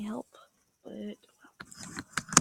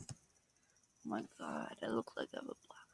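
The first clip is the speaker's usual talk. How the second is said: horrified whisper; 8 dB quieter than usual. Real, not acted. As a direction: gasp it out under the breath.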